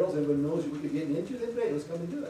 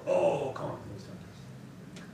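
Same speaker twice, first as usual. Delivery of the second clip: hushed, slow, awed voice of a friend; friend's voice; much slower than usual. Retold or imitated. Pastor voicing his friend recalling the concert, hushed and awed.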